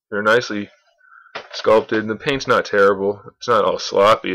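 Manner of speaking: usual speaking voice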